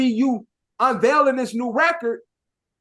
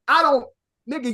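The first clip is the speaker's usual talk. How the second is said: usual speaking voice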